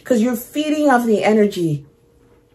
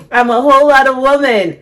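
louder, sassy boast; diva-style brag; proud sassy declaration; 4 semitones higher, 6 dB louder than usual. Acted. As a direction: own it, loud and proud. Proud self-declaration after song.

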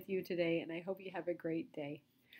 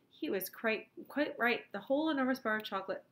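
ordinary delivery

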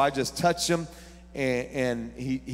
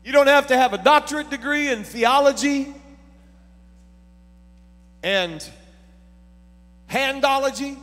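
much higher, louder sermon line; loud, higher preaching to the congregation; preaching loudly; 11 semitones higher, 7 dB louder than usual. Real, not acted. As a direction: push it out to the room. Shouted sermon point.

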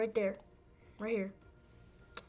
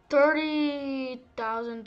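voice crack; 4 semitones higher, 8 dB louder, slower than usual. Real, not acted. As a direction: voice cracking upward. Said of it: "Sorry for my voice crack".

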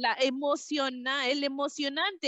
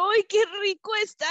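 high, fast, louder delight; 9 semitones higher, 4 dB louder, much faster than usual. Acted. Cow tasting her rainbow milkshake, high delighted exclaim. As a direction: exclaim it with greedy joy.

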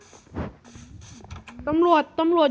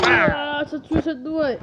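slow, louder, desperate wail; cry for help; 3 dB louder, slower than usual. Acted. Stabbed guard cries out slowly for help. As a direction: scream for help as you're stabbed.

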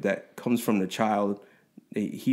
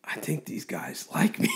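giddy whispered thought; 4 semitones higher, 5 dB quieter than usual. Retold or imitated. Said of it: "I remember journaling".

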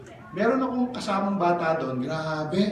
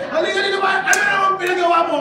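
put-on higher, louder mother calling the child; imitating a mother scolding her hurt child, loud and high; mother yelling; 12 semitones higher, 8 dB louder, much faster than usual. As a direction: yell at the kid like an angry mom.

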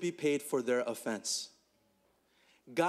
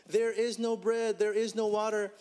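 higher, whiny grumbling crowd voice; the people complaining to Moses about the wilderness, raised and whining; complaining Israelites; 6 semitones higher than usual. Acted. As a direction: play the grumbling people in the desert. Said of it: "spoke against God and against Moses and said".

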